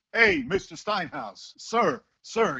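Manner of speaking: slow, high-pitched schoolboy calling out; imitating a pupil. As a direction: pupil calling his teacher in the corridor, imitated. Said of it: "he doesn't sound like this, obviously".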